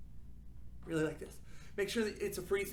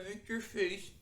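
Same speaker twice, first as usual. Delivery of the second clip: softer, squeezed into a tiny snail face; squeezed small voice; 3 semitones higher, 5 dB quieter, slower than usual. Shown as a demonstration. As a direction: shrink the voice along with the face. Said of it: "make your face as small as you can".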